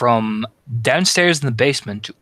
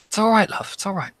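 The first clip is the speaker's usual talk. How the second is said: reassuring; 10 semitones higher than usual. Acted. NPC reassures frightened girl.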